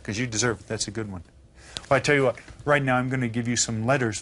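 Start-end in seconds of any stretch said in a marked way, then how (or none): none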